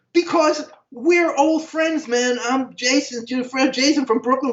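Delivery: very high, drawn-out explanation, re-enacted; excited insistence. Old friend identifying himself backstage, excited insistence. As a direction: urge him to remember you.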